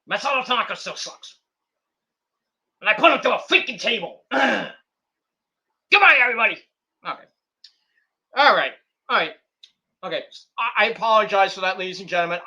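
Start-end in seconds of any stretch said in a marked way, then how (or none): 0.1-6.6 higher, louder wrestler promo voice; lightly voiced brash wrestler; Mike Awesome impression. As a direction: bark out a cocky wrestler promo. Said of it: "my Mike Awesome impression".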